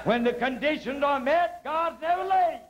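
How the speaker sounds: high, lifted preacher's cadence; rising sermon delivery; preaching. Acted. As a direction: preach it out to the congregation. Preaching testimony at full force.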